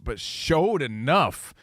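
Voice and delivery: showed enough said slowly and flatly, each word weighed; slow deliberate quote. Shown as a demonstration. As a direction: drag out showed enough with doubt. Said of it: "And that is the quote".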